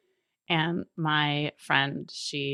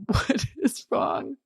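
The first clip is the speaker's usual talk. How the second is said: dog's voice; 8 semitones higher, slower than usual. Retold or imitated. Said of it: "My poor dog is like".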